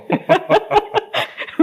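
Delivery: high-pitched laughter; amused laugh. Real, not acted. Interviewer reacting to the guest's complaint plan, amused laugh. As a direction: burst out in a high, real laugh.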